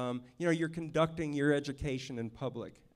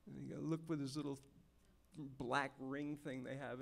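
ordinary talk, nothing marked

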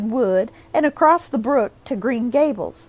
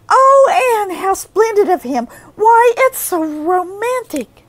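high, loud romantic gushing; breathless gush; 10 semitones higher, 8 dB louder than usual. A narrator's reading. Jane gushing over the rescue, breathless. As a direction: swoon over it. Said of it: "finding breath enough for utterance at last".

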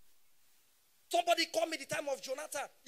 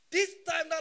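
normal talking voice

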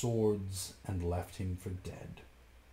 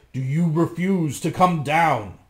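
louder angry roar; shouting; 4 semitones higher, 8 dB louder than usual. A narrator's reading. Bluebeard shouting up at his wife, furious. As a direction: bellow the question. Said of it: "shouted Bluebeard".

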